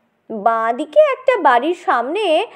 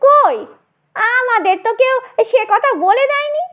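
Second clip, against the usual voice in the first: objecting; 9 semitones higher than usual. Acted. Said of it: "Nitai আপত্তি করল".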